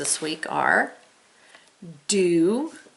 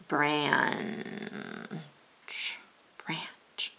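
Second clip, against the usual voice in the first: whispered sounding out; 3 semitones lower, 9 dB quieter than usual. Shown as a demonstration. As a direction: breathe the word softly.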